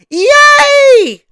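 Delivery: very high, loud storybook scream; long piercing shriek of fright; terrified scream. Acted. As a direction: scream as if jolted out of a nightmare. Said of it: "leaped out of bed screaming".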